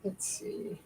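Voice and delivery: a breathy whisper, "Let's see"; whispered to herself. Real, not acted. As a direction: whisper it while concentrating. Peering at the fabric, unvoiced.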